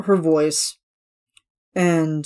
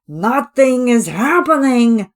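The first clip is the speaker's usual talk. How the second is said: much higher, louder, slow silly bird voice; seagull character voice; 7 semitones higher, 7 dB louder, slower than usual. Retold or imitated. Ellie's seagull character, high squawky comic voice. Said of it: "doing his character as".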